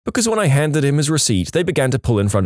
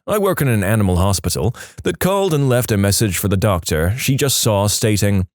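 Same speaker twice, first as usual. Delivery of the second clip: ordinary delivery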